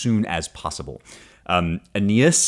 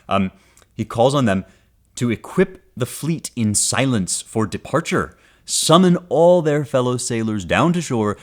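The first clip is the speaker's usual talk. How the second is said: poem reading; 6 semitones higher, 3 dB louder than usual. Acted. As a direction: let each word of the epic land. Reading the translation aloud.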